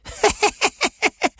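high-pitched mocking cackle; spiteful laugh. A narrator's reading. Policewoman laughs at the angel's threat, high and spiteful. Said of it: "Da lachte die Politesse gehässig".

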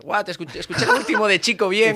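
high, loud gushing praise, hammed up; mock rave. Host retelling his praise of the guest, hammed-up excitement. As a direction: gush like a fan blown away. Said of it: "Le dije".

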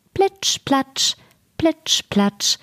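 high, sing-song splashes with even beats; splashing sound words. A narrator's reading. Frog creeping up the marble stairs, playful splash imitation.

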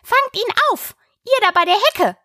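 very high, urgent rabbit voice; rabbit calling out. A narrator's reading. The rabbit orders those by the hedge, high urgent call. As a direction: call sharply to those further off. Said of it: "dann die Stimme des Kaninchens allein".

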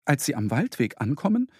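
usual speaking voice

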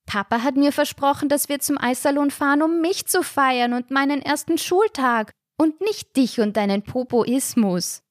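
put-on high, wailing little-boy complaint; whining child. A narrator's reading. Little brother wails at Papa over the ice cream. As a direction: bawl it out like a jealous little brother. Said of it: "Da fing Lasse an zu heulen".

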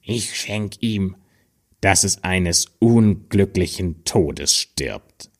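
grim little man, slow and hushed; ominous dwarf curse. Acted. Third dwarf curses her.

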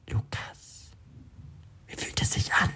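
whispered voice in his head; a faint whispered inner voice; whispery telepathic voice. A narrator's reading. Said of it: "fragt die Stimme ... mit einem Lachen".

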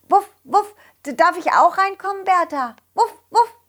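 high, quick puppy voice; cartoon dog. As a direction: ask like an eager pup. Dog asks.

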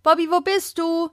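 high calls across the garden; calling out. Acted. Children search the garden for the budgie, high calling. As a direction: call the lost bird, voice pitched high.

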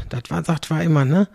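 ordinary talk, nothing marked

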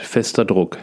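ordinary delivery, nothing marked

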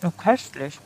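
talking with mouth full; chewing while talking. Real, not acted. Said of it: "ich spreche mit vollem Mund".

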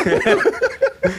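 sehr hohes, helles Auflachen; lachen. Real, not acted. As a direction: lach hell und hoch los. Laughs at being quoted. (helles hohes Lachen)

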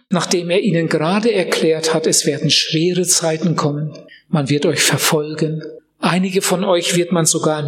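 ordinary delivery

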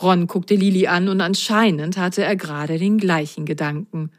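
ordinary delivery, nothing marked

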